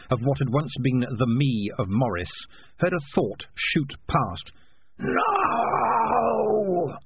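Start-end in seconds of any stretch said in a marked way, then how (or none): 5.0-7.0 long, high drawn-out wail; desperate, stretched-out high cry; anguished cry. Acted. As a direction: cry it out high and hold it, falling at the end. Dying Morris hears a desperate thought.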